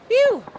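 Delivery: big high sigh of relief; loud whoosh of relief; loud relieved phew. Acted.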